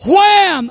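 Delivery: high, punched "wham"; exclaimed impact. Retold or imitated. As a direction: act out being hit by a jolt. The power hits once more.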